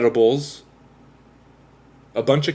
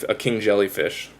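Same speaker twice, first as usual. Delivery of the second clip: normal talking voice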